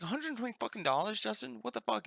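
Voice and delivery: high, fast shocked outburst; shocked disbelief. Retold or imitated. Friend hears the cologne price, high shocked outburst.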